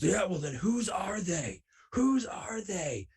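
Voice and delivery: high, slow, drawn-out mock scream; Maverick screaming. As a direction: imitate an angry interrogator, stretching each word high. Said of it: "he's screaming at me".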